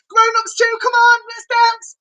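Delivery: high, loud sing-song call; excited invite. Acted. Presenter calling the grown-ups to dance, high sing-song call.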